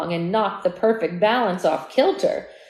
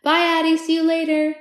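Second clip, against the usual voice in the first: louder, higher farewell call; cheerful shout-out goodbye to Addie; 7 semitones higher, 6 dB louder than usual. Real, not acted. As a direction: call it across the room. Calls goodbye to someone present.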